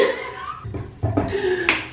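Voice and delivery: high, breath-only laugh. Real, not acted. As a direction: laugh at your own joke. Laughs at own joke about money.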